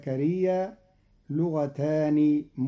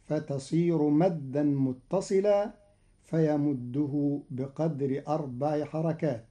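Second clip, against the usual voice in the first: usual speaking voice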